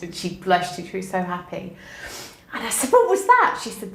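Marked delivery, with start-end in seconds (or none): 2.9-3.6 much higher and louder, astonished; amazed question. Retold or imitated. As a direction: burst out in delighted surprise. Teacher amazed after student's presentation.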